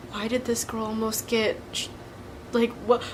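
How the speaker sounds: voiced softly, as classmates' hushed wondering; hushed gossip. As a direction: murmur it like curious classmates. Classmates wondering what happened.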